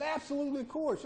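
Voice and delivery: put-on high old man voice. Preacher voicing the old farmer welcoming the hunter in.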